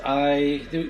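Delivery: normal talking voice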